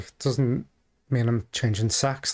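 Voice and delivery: ordinary talk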